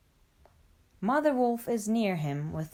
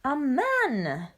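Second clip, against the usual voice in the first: higher-pitched startled exclamation; alarmed cry; 5 semitones higher, 3 dB louder than usual. A narrator's reading. Father Wolf hears of a man, startled cry. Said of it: "cries Father Wolf".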